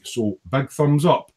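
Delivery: normal talking voice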